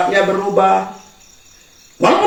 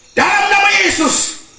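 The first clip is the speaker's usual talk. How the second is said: deliverance prayer; 8 semitones higher than usual. Retold or imitated. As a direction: cast the spirit out in Jesus' name. Pastor casts out spirit.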